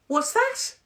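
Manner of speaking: high, loud attempt at his accent; puzzled question in a badly faked accent; James English. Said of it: "This is James English".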